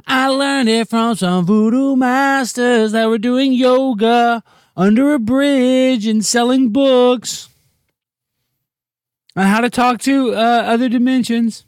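high, slow spooky guru voice; mock mystic. Telling viewers about voodoo masters in a slow high guru voice. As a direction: drone it like a batty mystic.

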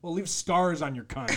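ordinary talk

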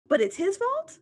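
can't believe it, loud high question; incredulous. Retold or imitated. Outraged retort defending the stepdad.

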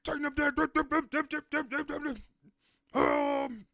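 high, drawn-out comic instructor cry; mock dance instructor. Friend imitating the dance camp instructor, high hammed-up orders. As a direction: call it out high, like a silly drill. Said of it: "And he's just like".

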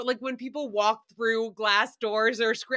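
ordinary talk, nothing marked

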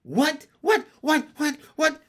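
high, loud little echoes; high staccato chorus of questions; chorusing froglets. A narrator's reading. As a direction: pipe it like startled froglets. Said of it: "chorused the froglets".

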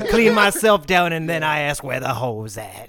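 slow, loud swaggering comic; Scat Williams impression. Riffing as the comic in France, slow mock swagger. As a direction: draw it out as a bragging stand-up.

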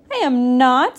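indignant retort. A narrator's reading. Frog argues back with Duck.

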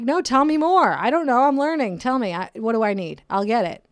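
high, loud, eager to learn; mock eager reply, lots of energy. Host retelling her eager answer to a nurse, high bright eagerness. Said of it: "And I'm like".

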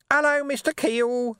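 very high, slow, gluey greeting; glutinous voice. A narrator's reading. Urchin greeting the sergeant from below, glutinous. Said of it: "said a glutinous voice".

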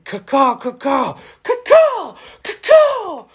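shrill screeching bird woman. Pretend. Battlehawks cheer, bird call.

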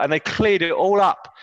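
ordinary talk, nothing marked

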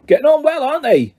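a proud gardener showing off his pumpkins; happily. A narrator's reading. Hagrid proudly shows his pumpkins to the kids, happily. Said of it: "said Hagrid happily".

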